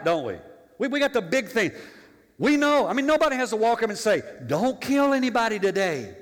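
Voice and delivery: loud, high preacher drive; fiery preaching. Acted. Pastor preaching to the congregation at full force. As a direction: press it hard on the congregation.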